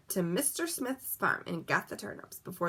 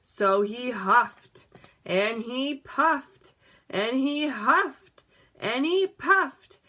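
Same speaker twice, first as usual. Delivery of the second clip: slow, each phrase louder and higher; building huff and puff; 4 semitones higher, 4 dB louder, much slower than usual. A narrator's reading. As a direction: build up like a wolf taking a big breath. Narrating the wolf's blowing.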